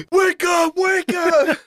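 very high frantic shouting; panicked shriek. Pretend. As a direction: scream it in a panic. Shrieking wake up at captain.

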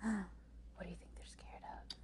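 soft, breath-only whisper; hushed suspense. Real, not acted. Reader asks listeners about the scared sisters, whispering.

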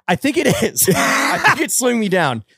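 laughing. Real, not acted. Laughing about wearing shapewear.

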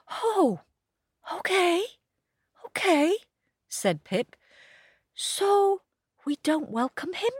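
high, eager puppy voice; little dog voice. A narrator's reading. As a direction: squeak it like a small dog. Pip voiced.

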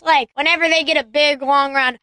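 normal talking voice

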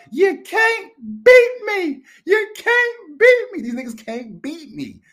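high, loud, slow mocking taunt; Buddy Love taunt. Buddy Love gloating at Sherman Clump, high and loud. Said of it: "Buddy Love was telling fat ass Sherman Clump".

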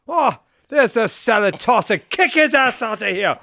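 high, loud mock Irish shout; hammed-up Irish barman throwing him out; angry Irish pub regular. Pub regulars to the tourist, loud mock Irish shout. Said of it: "they'd be like".

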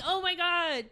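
high, hushed fangirl thought; awed, not panicking. Retold or imitated. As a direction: imitate a dazed inner squeal, drawn out. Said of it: "Not panicking, just being like".

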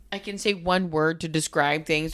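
ordinary talk

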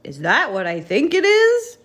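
high, loud incredulous question; booming astonishment. A narrator's reading. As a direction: boom it out, rising in disbelief. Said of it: "boomed Digger".

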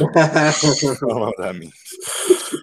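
very slow, drawn-out deadpan; drawn-out repeat. Shown as a demonstration. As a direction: stretch every word out slowly. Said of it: "repeated slowly".

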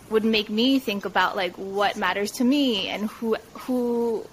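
ordinary talk, nothing marked

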